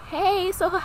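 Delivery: high, put-on casual greeting; feigned casual. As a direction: try to sound like nothing's wrong. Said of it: "trying to, like".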